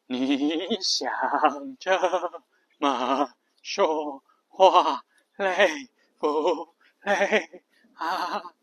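very slow, creaky fry through the whole phrase; vocal fry. Shown as a demonstration. Teacher shows how tiring full fry is, very slow. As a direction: press every word into the throat.

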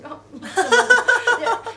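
高调、放慢、带笑地学对方哭着问; 半开玩笑地学他哭哭啼啼的样子; 模仿哭腔问话. Retold or imitated. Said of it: "那种哭哭的那种表情". (笑着模仿西西哭兮兮地问"你怎么了")

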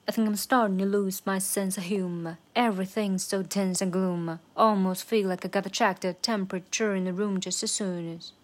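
brisk full-tempo verse demo; normal speed. Shown as a demonstration. Teacher showing learners the verse at regular speed, brisk and rhythmic. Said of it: "常速版".

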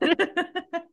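high giggle; high laugh. Real, not acted. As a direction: giggle at the joke. Laughing at creak.

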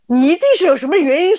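笑着复述老师的话，音调略高; 忍不住笑出来地学老师; 边笑边学老师说. Retold or imitated. Laughing while retelling teacher. (回忆被老师冤枉时笑着模仿老师)

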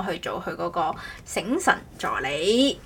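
ordinary talk

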